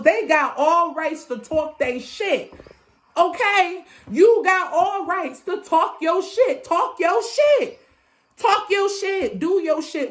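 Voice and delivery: high, slow, drawn-out affirmation; emphatic hyping up. Real, not acted. Hyping listeners to speak up for themselves, emphatic and drawn out. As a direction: stretch each word and drive it home.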